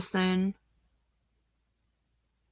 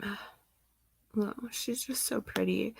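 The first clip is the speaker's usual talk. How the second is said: hushed, choked-up whisper after a sigh; near tears; 9 dB quieter, slower than usual. Real, not acted. Vlogger gazing at the missing girl's photo, whispering through tears. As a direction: barely get the words out, holding back real tears. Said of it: "I'm gonna start crying again".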